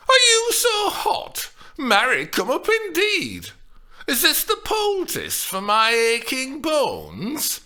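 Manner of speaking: high, loud indignant scolding; offended retort. A narrator's reading. Nurse scolds Juliet, offended and indignant. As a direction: huff at her, offended.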